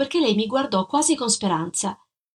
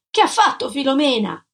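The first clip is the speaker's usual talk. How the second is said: raised, higher demanding question; shouted question; 5 semitones higher, 4 dB louder than usual. A narrator's reading. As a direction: push the question, insistent. (domanda gridata)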